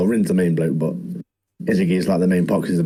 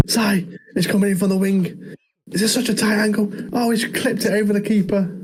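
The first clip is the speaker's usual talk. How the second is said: high, hyped play-by-play call; mock football commentary; 12 semitones higher than usual. Friend joins in commentating the goal, excited commentator. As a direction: narrate the chance like match commentary. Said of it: "Us two commentating it".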